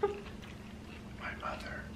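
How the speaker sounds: very soft, slow whisper; hushed, drawn-out whisper; whispered aside. Real, not acted. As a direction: whisper it like a confession. Aside naming the hoarder.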